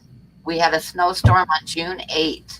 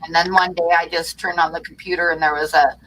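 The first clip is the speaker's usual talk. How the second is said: normal talking voice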